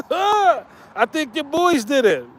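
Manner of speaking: slow taunting. Retold or imitated. Quoting himself to Reg.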